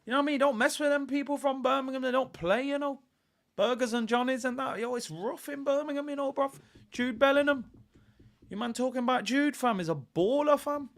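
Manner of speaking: high-pitched mock Brummie accent; Birmingham accent. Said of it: "thought that was a decent Birmingham accent".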